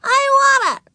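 put-on high, loud child's scream; screaming refusal. A narrator's reading. Ramona keeps the lollipop from Wayne, screaming. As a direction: shriek it like a defiant little girl. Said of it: "screamed Ramona".

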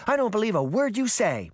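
put-on high-pitched defiant little girl; indignant child's cry. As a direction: refuse to believe it, like a cross kid. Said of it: "Daphne cried".

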